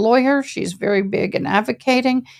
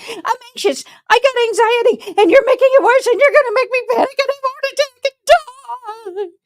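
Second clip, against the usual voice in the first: high, hammed-up anxious wailing; mock panicky whine; 14 semitones higher than usual. Mocking the faker's fake panic attack, high and shrill. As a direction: whine like a drama queen.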